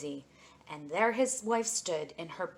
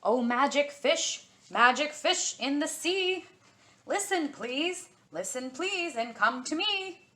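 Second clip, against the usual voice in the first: high, louder chant-like call across the water; 8 semitones higher, 5 dB louder than usual. Acted. The fisherman calls out over the sea to the magic fish, in a chant-like call. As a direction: call the fish like a summoning rhyme. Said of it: "he called out".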